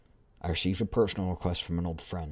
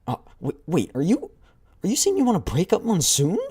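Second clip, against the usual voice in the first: much higher, louder, disbelieving cry; shocked exclamation; 12 semitones higher, 10 dB louder than usual. Acted. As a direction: blurt it out in alarm.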